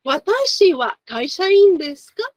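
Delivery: "Watashi wa kaishain desu ka?" lifted, going up at "ka"; rising question intonation. Shown as a demonstration. Teacher models a question for the class, rising question intonation on "ka". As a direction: make "desu ka" climb as a clear question. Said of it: "with the intonation going up".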